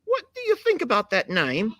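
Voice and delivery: a hammed-up British voice, high; mock British question; British accent. Host voicing a British accent for the goat. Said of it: "Do I sound anything like British?".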